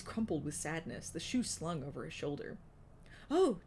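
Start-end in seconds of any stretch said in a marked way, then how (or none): 3.3-3.6 startled oh; sharp surprised gasp of a word; surprised exclaim. Acted. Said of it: "Tukey exclaimed".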